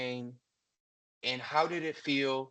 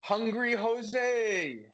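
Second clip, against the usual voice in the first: high, drawn-out call with a falling end; slow, exaggerated name-game shout; mock hungry; 7 semitones higher, much slower than usual. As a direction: call out the name, hamming up the hunger. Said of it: "passing the emotion that goes to it".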